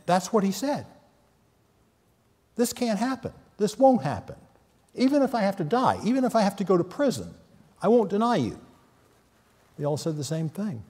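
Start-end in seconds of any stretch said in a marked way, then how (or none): 2.5-8.6 a confident vow, pitched well up; Peter's proud vow, hammed up high; boastful Peter. Peter boasting he won't fail.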